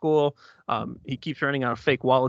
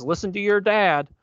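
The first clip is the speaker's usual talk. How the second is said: lightly voiced homeless guy, pitched up; mock-stern interjection, higher voice; imitated bum; 6 semitones higher, 3 dB louder than usual. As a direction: chime in as the bum, a hint of character. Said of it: "the homeless guy, the bum, says".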